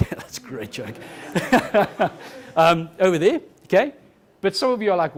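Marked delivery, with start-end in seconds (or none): 0.0-2.1 slow, low chuckle through the words; laughing. Real, not acted. Speaker enjoying their own joke on stage, laughing. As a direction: laugh slowly through it, voice dropped.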